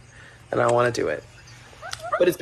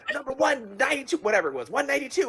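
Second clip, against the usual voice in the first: excited announcement; 9 semitones higher than usual. Retold or imitated. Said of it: "my friend's like".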